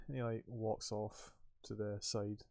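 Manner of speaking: ordinary talk, nothing marked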